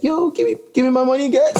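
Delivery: high-pitched starving-artist plea, imitated. Mimicking a video host reading an artist's post, high starving-artist voice. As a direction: squeak it out like a broke, begging artist. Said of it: "like, a starving artist voice".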